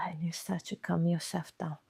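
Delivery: soft unvoiced whisper. Shown as a demonstration. Speaker guiding listener to calm down, whispered. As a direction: let it settle into a whisper. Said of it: "calming demonstration".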